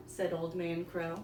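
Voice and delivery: ordinary delivery, nothing marked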